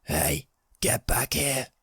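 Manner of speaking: understated croaky yell, slowed down; a slow, restrained croaky call, not really yelled. A narrator's reading. Intruder yells after the fleeing homeowner, mildly croaky. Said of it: "a croaky voice yell".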